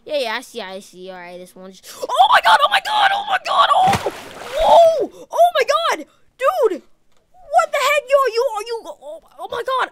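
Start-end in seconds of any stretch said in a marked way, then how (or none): 2.0-9.9 high, loud panicked yelling as the car drops; panicked screaming. Real, not acted. Driver screaming as his car falls off the bridge, panicked. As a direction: scream in real shock.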